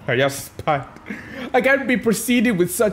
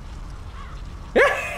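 breathy high giggle at the enemy; mocking giggle; 6 dB quieter than usual. Real, not acted. Gamer giggling at a helpless enemy, mocking giggle. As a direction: giggle at a foe who can't land a hit. Taunting enemy who can't hit.

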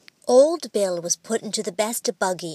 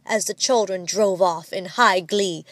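usual speaking voice

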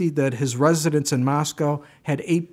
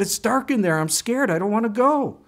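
put-on scared little boy; 7 semitones higher than usual. Preacher voicing Johnny refusing his mother, scared. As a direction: play the boy who won't go into the pantry. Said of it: "But he was afraid".

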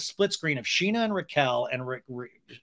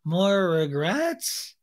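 sarcastic slow question; 4 semitones higher, much slower than usual. Pretend. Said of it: "And I'm like".

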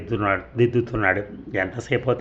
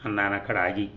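usual speaking voice

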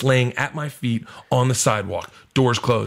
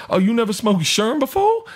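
high, incredulous comic question; mock surprise rising at the end; retold comic dealer; 11 semitones higher than usual. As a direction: put on his voice, pitched up and amazed. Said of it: "he's like".

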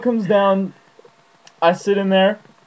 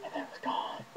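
soft breathy whisper of wonder; awed whisper; 21 dB quieter than usual. Acted. Watching the crow vanish, hushed whisper. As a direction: whisper it as if the magic just left.

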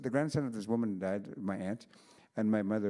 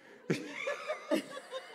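high unvoiced giggle; breathy giggle. Real, not acted. Teller laughing at her own pun, breathy giggle. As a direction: let a breathless laugh escape.

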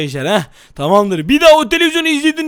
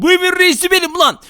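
mock boss bluster; 6 semitones higher than usual. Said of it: "artık çok sinirliyim".

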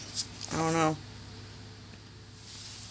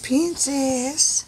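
high, sing-song coo to the pets; pet-talk voice; 6 semitones higher than usual. Real, not acted. Owner cooing up high at chinchillas that keep running off.

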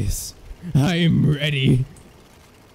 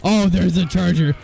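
usual speaking voice

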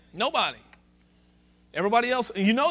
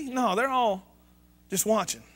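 ordinary delivery

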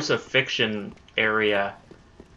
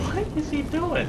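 jumps high in disbelief; baffled squeak; 4 dB quieter than usual. Real, not acted. Player squeaks at the gargoyle's strange moves, very high. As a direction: let the pitch leap up in confusion.

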